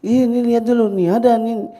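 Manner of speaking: put-on high, loud excited child voice. Child urging mother to look, loud and high. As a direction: insist loudly, high-pitched like a child. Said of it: "suaranya boleh pelan-pelan".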